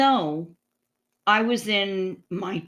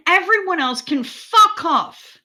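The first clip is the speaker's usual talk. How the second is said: emphatic dismissal; 7 semitones higher, 5 dB louder than usual. Real, not acted. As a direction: drag it out like a verdict.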